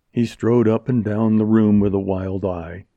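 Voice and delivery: plain reading voice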